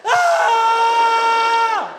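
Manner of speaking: long high-pitched scream of panic; sustained hammed-up shriek; terrified scream. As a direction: scream while fleeing in terror. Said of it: "entro al cuarto y grito".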